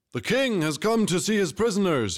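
high, ringing herald's shout; shouted announcement. A narrator's reading. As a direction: call it out to the whole camp. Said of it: "he shouted".

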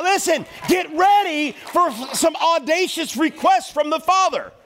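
high-pitched, full-force exhortation; fervent preaching. Real, not acted. Preacher urging church to obey God, impassioned preaching.

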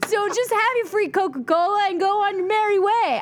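high, whiny mock rant with a hint of a cry. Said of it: "and I was like".

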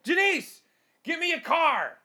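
high bossy order to Denise; bossing the assistant. Pretend. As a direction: demand it like a fifties executive. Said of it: "assistant named Denise".

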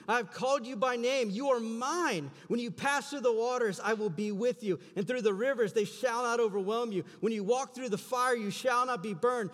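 full-force preacher reading God's promises; preaching scripture. Acted. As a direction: proclaim each promise with rising fervour.

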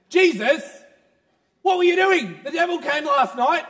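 high, loud, indignant; upset accusation. Acted. Man demanding why Jesus did not help, loud and indignant. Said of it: "The gentleman says".

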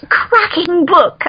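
high, drawn-out mock British accent; cute British. Author quoting the editor fondly, cute British accent. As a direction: relish it slowly in imitation British. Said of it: "so cute and British".

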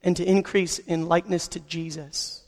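hushed, earnest prayer voice. Acted. Pastor praying aloud for the congregation, hushed and earnest. Pastor prays before sermon.

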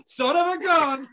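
rhyming hype-man intro; announcer intro. Acted. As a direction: introduce him like a ring announcer.

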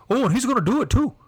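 high, quick Wisconsin gossip; sing-song mock Wisconsin; Wisconsin accent. Shown as a demonstration. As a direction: react in a hammed-up Wisconsin accent. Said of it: "what do they say".

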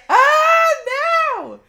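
shrieked, high and loud, half laughing; a laughing wail of dismay; anguished shout. Retold or imitated. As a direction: cry out in mock despair. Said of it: "And I was like".

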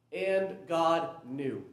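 slowly, and God knew; slow, weighty reading. Shown as a demonstration. Pastor reading the ESV wording aloud to his congregation, slow and weighty. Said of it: "I love how the English Standard Version words this".

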